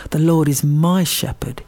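"my" hit hard and drawn out; slow verse with the weight on "my"; stress on my. Shown as a demonstration. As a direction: lean firmly on the word "my". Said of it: "And then I emphasise the word".

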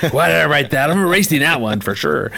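dad scoffing, crossing out his own words; mock indignant dad. Dad rereads his list and scoffs.

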